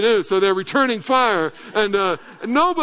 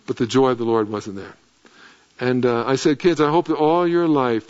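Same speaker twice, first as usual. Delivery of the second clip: tender blessing; 7 semitones lower than usual. Retold or imitated. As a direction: bless the children gently, from the heart. Tender blessing on his kids.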